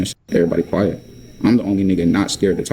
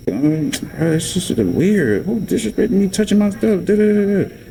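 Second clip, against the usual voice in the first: much higher, drawn-out imitation of her griping; mocked complainer; 10 semitones higher than usual. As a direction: mimic an indignant rant in a high voice. Mimics her continued complaining.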